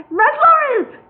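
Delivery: very high, loud shriek. A narrator's reading. Solomon shrieks his answer under pressure. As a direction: blurt it out in panic. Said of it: "shrieked Solomon".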